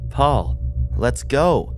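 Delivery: insist, drawn out; urging again. A narrator's reading. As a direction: Maria calls him back.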